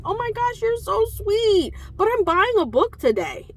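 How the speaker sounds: high, sweet gushing thanks; gushing delight. Retold or imitated. Thanking the owner for being so sweet, gushing delight. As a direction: gush like he's the sweetest.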